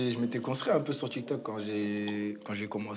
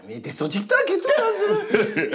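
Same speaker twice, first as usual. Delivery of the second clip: higher, louder put-on mum; mother's voice; 9 dB louder than usual. Mother handing her son a TikTok t-shirt, pitched-up teasing voice. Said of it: "Elle m'a fait".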